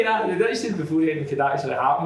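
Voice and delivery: ordinary delivery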